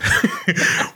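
sudden high laugh breaking out; high burst of laughter. Real, not acted. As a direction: let a high laugh escape. Laughs at own joke.

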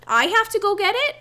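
can't believe he asked; incredulous. Retold or imitated. Incredulous at being sent.

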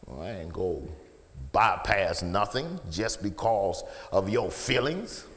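ordinary talk, nothing marked

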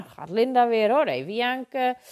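loud imitated taunt; spottend nagedaan. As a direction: mimic people jeering at a crybaby. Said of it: "dat was wat er tegen mij zei". (luid en spottend nagedaan)

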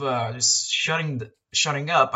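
ordinary talk, nothing marked

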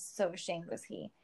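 usual speaking voice

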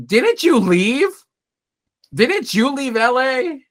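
high, slow incredulous question; mock disbelief. Talking back to Mary on screen, in slow high mock disbelief. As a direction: drag it out in mock outrage. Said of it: "And I'm like".

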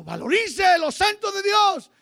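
loud, rising sermon delivery; fiery pulpit preaching; full-force preaching. Acted. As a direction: preach it hard to the congregation. Preaching climax with music.

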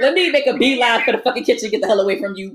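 high, loud teasing parody of a friend; mock eager escape. Teasing her friend's dodge, mocking loud and high. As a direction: send it up like a friend fleeing to the kitchen.

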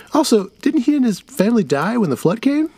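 put-on a clever kid catching the mistake; boy voice. Kid David questions.

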